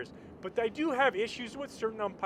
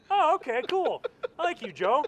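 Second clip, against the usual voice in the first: pleasantly surprised; 9 semitones higher, 7 dB louder than usual. Retold or imitated. Won over by the umpire's wink.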